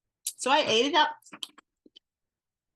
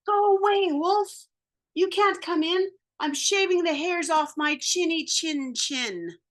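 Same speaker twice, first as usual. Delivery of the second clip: pig yelling; 6 semitones higher than usual. Retold or imitated. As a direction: shoo the wolf off, shrill. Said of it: "The pig yelled back".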